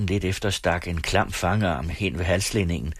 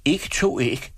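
higher-pitched, earnest little denial; credible denial; 9 semitones higher, slower than usual. A narrator's reading. Boy answering his father about the eggs, credibly denying. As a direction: deny it like a believable child. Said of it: "nægtede Sivert troværdigt".

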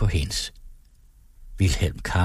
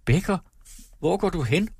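higher, alarmed calling out; pale, frightened cry; 9 semitones higher than usual. A narrator's reading. Wife crying after her leaving husband, frightened. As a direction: cry it after him in sudden fear. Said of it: "råbte hun bleg".

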